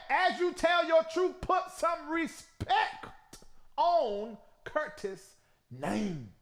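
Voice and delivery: slow, stressed put some respect; stern warning. Real, not acted. Host warning the singer about Curtis, stern and slow. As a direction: hammer each word home.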